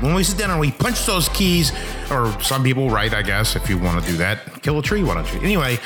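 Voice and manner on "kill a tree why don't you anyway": ordinary talk, nothing marked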